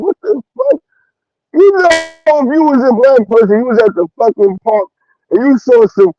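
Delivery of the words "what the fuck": high, squeaky disbelief, much higher; mock shocked squeal at the neighbours' grill; baffled what the fuck. Watching strangers barbecue at the park, high baffled squeal. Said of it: "we was like".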